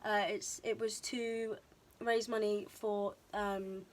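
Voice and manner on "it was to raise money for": ordinary talk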